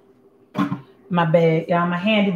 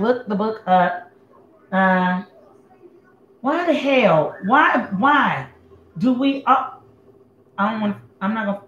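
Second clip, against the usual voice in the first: usual speaking voice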